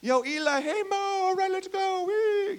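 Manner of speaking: put-on high excited kid voice; kid playing with toys. Peter as a boy playing with Moses and Elijah figures, high excited kid voice. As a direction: play like a little boy with action figures. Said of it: "have the action figures when he was younger".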